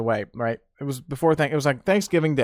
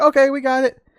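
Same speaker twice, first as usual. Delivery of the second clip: high, louder cheerful okay; eager agreement; 12 semitones higher, 6 dB louder than usual. Retold or imitated. Grandkids agreeing to fetch groceries, high and bright. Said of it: "we're like".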